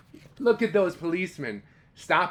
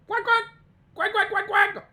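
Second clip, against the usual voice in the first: much higher, louder duck quacking; duck quacks; 6 dB louder than usual. Shown as a demonstration. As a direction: quack like a duckling. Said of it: "How do you think they said thank you?".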